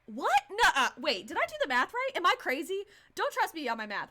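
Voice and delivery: normal talking voice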